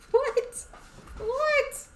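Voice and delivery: ordinary talk, nothing marked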